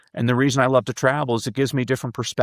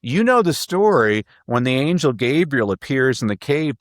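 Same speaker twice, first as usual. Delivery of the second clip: high, bright fake realization; mock surprise; 5 semitones higher than usual. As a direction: act as if it just dawned on you. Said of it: "I'm like".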